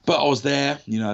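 ordinary talk

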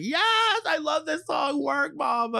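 high, gleeful fangirl shout; excited cheering. Retold or imitated. Fan among baffled younger friends, excited high cheer. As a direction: shriek it out high, reliving the excitement. Acting out own excited reaction to song.

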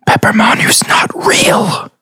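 taunting whisper; eerie whispered taunt; creepy whisper. Acted. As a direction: hiss it like a shadow in his head. Shadow taunting the character.

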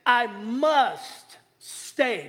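very slow and deliberate; drawn-out must. Shown as a demonstration. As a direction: stretch every word. Preacher drawing out Jesus' words.

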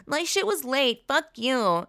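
high, mocking voice of an entitled customer; whiny customer. Mocking a rude reviewer in a high whiny voice. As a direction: sneer it out like a spoiled online shopper. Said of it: "ignorant fool being like".

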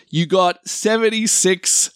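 slow, drawn-out score announcement; dramatic reveal. Real, not acted. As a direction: draw out each word for suspense.

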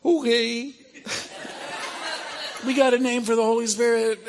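high, elated exclamation with laughing; delighted. Retold or imitated. Reacting to Geetika's definition of the name, delighted. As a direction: pitch way up, thrilled at the find.

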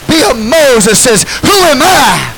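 loud, high preaching build; fiery pulpit preaching; full-force preaching. Real, not acted. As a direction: preach it out to the congregation.

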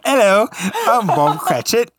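a clumsy attempt at an English accent as Bob Cratchit; bad English accent. At a murder mystery party, Bob Cratchit greets in a bad English accent. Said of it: "how to have an English accent".

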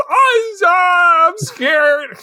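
high, loud fake wail; mock crying. Frightened man explaining himself in class, mock sobbing. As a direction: bawl it like a terrified kid, hammed up. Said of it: "he just cries and throws up".

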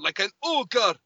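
lightly Scottish cartoon ogre voice; Shrek. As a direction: slip briefly into a Scottish ogre's voice. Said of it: "you sounded so much like Shrek".

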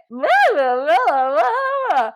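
high, slow nonsense babble; dazed babble. Retold or imitated. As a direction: babble like you're concussed. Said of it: "And I was like".

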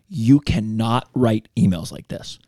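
slow, firm rebuke; firm Italian director, slow; slow stern boss. Retold or imitated. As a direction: spell it out slowly like a stern boss. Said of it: "she was like".

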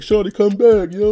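high, loud call after her; high mock plea in the rain; pleading call. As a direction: call out desperately after a girl leaving.

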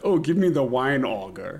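mock posh party guest. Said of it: "impress someone at a party".

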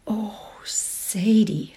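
breathy, overcome "oh"; little moan. A narrator's reading. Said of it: "the sound was like a little moan".

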